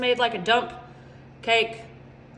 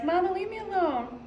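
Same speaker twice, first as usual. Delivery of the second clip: higher-pitched mock whine of a child; cranky child; 5 semitones higher, 4 dB quieter than usual. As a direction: lightly imitate a fed-up kid. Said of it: "he's getting cranky".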